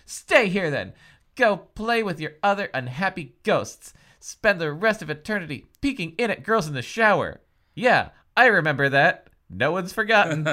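scolding. Acted. Heroine scolding ghost boyfriend.

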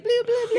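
high, silly burbling noise for anger; imitated scanner noise. Co-host showing a brain scan of anger, silly high noise. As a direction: babble it high, half laughing. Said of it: "when you're angry".

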